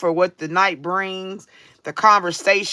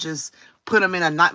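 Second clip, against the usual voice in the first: normal talking voice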